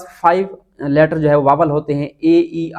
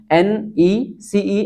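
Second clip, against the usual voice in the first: very slow spelling; 4 semitones higher, much slower than usual. Shown as a demonstration. Teacher slowly spelling necessary for students. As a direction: drag out each letter very slowly. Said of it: "तो necessary".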